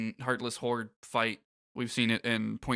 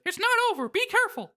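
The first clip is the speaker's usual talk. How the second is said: Mickey Mouse voice; 3 dB louder, faster than usual. Acted. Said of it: "Mickey says".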